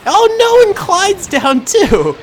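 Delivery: high, loud wail of alarm; mock dismay. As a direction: cry out in dramatic dismay, stretching the end. Sees second leader down.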